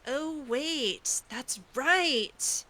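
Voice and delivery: ordinary talk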